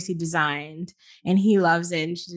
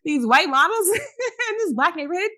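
high, laughing and incredulous; laughing disbelief; 10 semitones higher than usual. Real, not acted. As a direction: laugh through the outrage.

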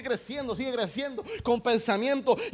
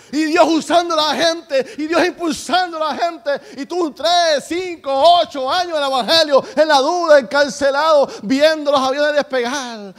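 loud, driving preaching, pitched up; prédica encendida; 5 semitones higher, 10 dB louder than usual. Real, not acted. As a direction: preach it at full force, hammering each phrase. Preacher at full force. (predicando a todo pulmón)

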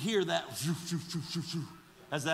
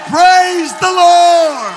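high, drawn-out preacher's shout; jubilant praise shout; 11 semitones higher, 18 dB louder, much slower than usual. Shown as a demonstration. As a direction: shout it out, stretching the words long and slow. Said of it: "wish somebody'd shout right".

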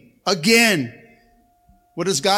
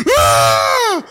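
mock wailing; 14 semitones higher, 7 dB louder than usual.

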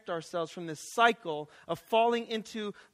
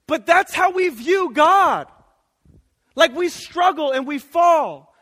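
louder, higher and slow, driving the point home; full-force preaching; 7 semitones higher, 11 dB louder, slower than usual. Acted. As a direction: preach it out to the room.

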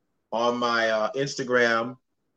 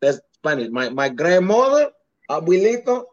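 Dominican accent impression. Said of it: "you sound just like one of them".

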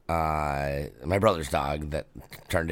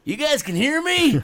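high, louder reply; surprised reply; 5 dB louder than usual. Retold or imitated. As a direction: act surprised at being heard. Brother answering from tent.

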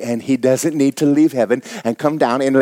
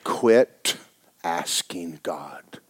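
soft, low, almost whispered; hushed; 10 semitones lower, 10 dB quieter, slower than usual. Real, not acted. Preacher hushing the congregation to drive the point home, nearly whispering. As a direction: drop to a near whisper to make it land.

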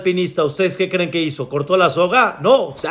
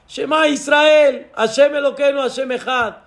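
desperate prayer cry; 7 semitones higher than usual. Acted. Falling climber prays Shema, desperate high cry.

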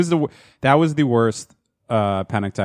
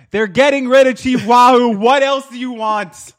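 much higher, louder, hammed-up indignant voice; mock outrage; 12 semitones higher, 5 dB louder than usual. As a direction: play a fake offended complainer, slowing at the end. Mocking an offended neighbor.

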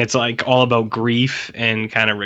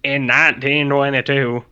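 lightly mocked rural farmer accent. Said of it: "talks in this weird farmer accent".

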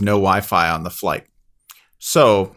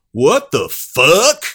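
loud, drawn-out mock outburst, rising at the end; imitated outraged passenger; 4 semitones higher, 7 dB louder, much slower than usual. Passenger in the back hears the score, loud drawn-out outburst. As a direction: stretch it into a loud, incredulous call. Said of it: "someone in the back go".

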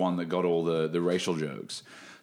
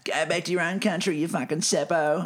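put-on high, sneering kid's voice; mocking schoolkid taunt; 9 semitones higher than usual. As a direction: taunt like a playground bully. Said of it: "kids taunting him at school".

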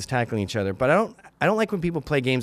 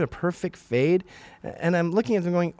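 ordinary delivery

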